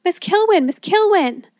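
high, loud shriek of a name; panicked shriek. A narrator's reading. Man calling his colleague as a dragon looms, panicked shriek. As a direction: shriek for help as the character. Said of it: "shrieked Hackenpfeffer".

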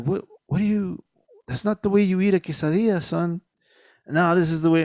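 a father scolding son over a quesadilla, mock-stern; scolding dad. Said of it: "And I'm like".

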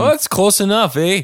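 mock up-north Midwest accent; northern Canadian-ish accent. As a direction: play up the Canadian eh. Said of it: "I guess they're up north there, eh?".

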